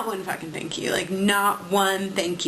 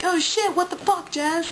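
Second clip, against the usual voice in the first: high, quick mock startled curse; mock high startled mom; mom's real reaction; 10 semitones higher than usual. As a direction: imitate mom cursing in surprise. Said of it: "She was just like".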